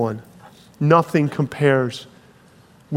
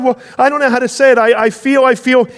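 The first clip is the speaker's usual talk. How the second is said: much higher and louder, bursting with joy; joyful cry; 8 semitones higher, 4 dB louder, faster than usual. Acted. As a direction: shout it like a hobbit who just woke up happy. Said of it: "he cried".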